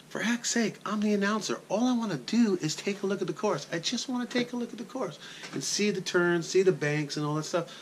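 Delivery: high-pitched, indignant pleading; exasperated protest. Retold or imitated. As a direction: whine it like a frustrated announcer denied his run. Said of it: "and I'm like".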